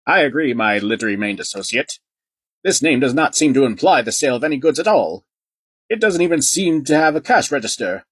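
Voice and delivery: a snooty gentleman toy declaring; haughty toy voice. Acted. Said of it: "Noodle Netty as declared".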